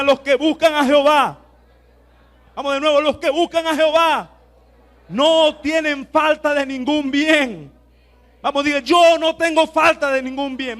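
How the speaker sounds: loud, high and slowing call to repeat; loud, high declaration for the church to echo; building proclamation. Acted. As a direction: lead the church, pushing harder. Congregation repeats, preacher builds.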